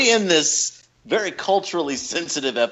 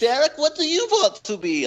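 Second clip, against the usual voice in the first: put-on higher-pitched German-accented teacher; 11 semitones higher than usual. German teacher asking students for their names, German accent. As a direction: imitate a German teacher addressing class. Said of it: "we had a German teacher".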